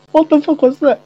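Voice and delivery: high shocked squeal with laugh. Real, not acted. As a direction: squeal in disbelief. Shocked at funny face.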